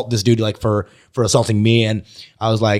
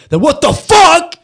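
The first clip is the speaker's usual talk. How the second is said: much higher, loud, slow furious yell; angry shout; 7 dB louder, slower than usual. Retold or imitated. Homeless man yelling at a stranger who has nothing. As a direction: blow up at him out of nowhere. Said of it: "And he's like".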